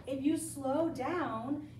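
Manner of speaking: slow it right down, word by word; slow emphatic repeat. Shown as a demonstration. Teacher urging her class to slow down, said slowly. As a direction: lean on each word of slow down, drawn out. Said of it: "I cannot emphasize this enough".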